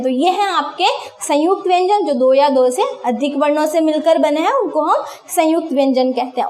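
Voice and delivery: normal talking voice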